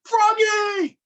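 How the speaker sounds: high, loud call. A narrator's reading. Mother calls Froggy, high loud shout. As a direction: call him from the next room. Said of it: "called his mother".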